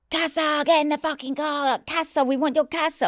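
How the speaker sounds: high, strange foreign gunman voice; mock gang shout; robbers yelling. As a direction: bark it like a gunman.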